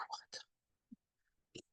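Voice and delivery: a shocked, hushed curse under the breath; whispered disbelief, almost no voice; stunned whisper. Retold or imitated. Stunned reaction retold.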